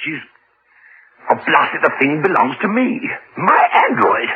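high, possessive outburst; angry protest. Acted. Owner refusing to give up his android, angrily possessive. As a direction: insist indignantly, pitch shooting up.

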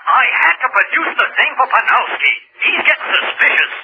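high, pressing, exasperated protest; agitated urging. Acted. Cullen demanding the android back from Andover, agitated. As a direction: press the case urgently, pitched high. Cullen pleads urgently by phone.

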